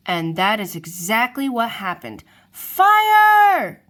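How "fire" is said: high, very loud cry of alarm; panicked shout. A narrator's reading. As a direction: scream the warning to the herd. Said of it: "they shouted".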